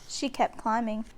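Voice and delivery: ordinary delivery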